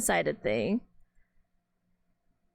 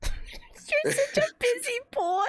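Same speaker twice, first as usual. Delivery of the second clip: high, slow, laughing through a syrupy line; mocking condescending sweet voice; 13 semitones higher, slower than usual. Repeating the game line to a friend, slowly and laughing. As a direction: savor each word like a cloying heroine. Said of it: "So condescending".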